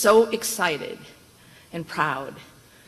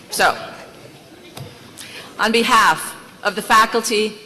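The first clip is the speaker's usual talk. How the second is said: podium speech; 5 dB louder than usual. Acted. Formal commencement address at podium.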